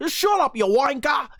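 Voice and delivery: high British accent, hammed-up "wanker"; mock British insult. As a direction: snap it like a cheeky British brawler. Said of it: "he'd be like".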